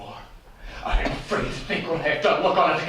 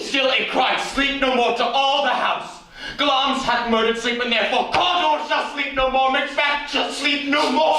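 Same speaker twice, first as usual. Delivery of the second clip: higher, louder, rushing panic; frantic cry; 8 semitones higher, 4 dB louder than usual. Acted. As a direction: cry it out in horror. Macbeth frantic over the voice.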